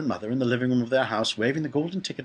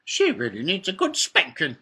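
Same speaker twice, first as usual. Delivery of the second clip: put-on high-pitched scolding old lady; character granny voice, pitched up; disapproving grandma; 6 semitones higher, 5 dB louder than usual. A narrator's reading. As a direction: pronounce it as a cross granny. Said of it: "said Georgina".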